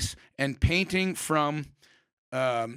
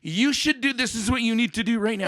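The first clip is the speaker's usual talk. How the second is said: high, bright-toned voice of the muse, much higher; 7 semitones higher, 3 dB louder than usual. Retold or imitated. Painter voicing her muse urging her on, high and louder. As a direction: speak as the inner muse, lifted up high. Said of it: "something coming to me going".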